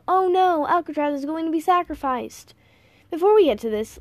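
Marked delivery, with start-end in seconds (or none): 0.1-2.5 high, hammed-up alarm; a mock cry of doom; mock panic. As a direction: play at being terrified for the hero. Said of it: "its general feeling of".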